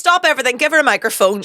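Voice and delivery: high, loud mock announcement; mock announcer. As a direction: playfully hand her the stage.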